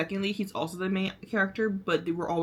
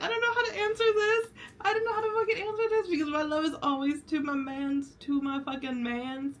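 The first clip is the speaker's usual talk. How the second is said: high excited voice; 11 semitones higher, faster than usual. Retold or imitated. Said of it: "He's like".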